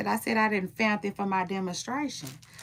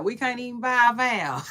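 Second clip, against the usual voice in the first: normal talking voice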